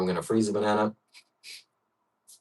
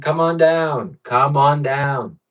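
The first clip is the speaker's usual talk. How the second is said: game show announcer; 6 semitones higher, 4 dB louder, much slower than usual. Pretend. Game show style call out.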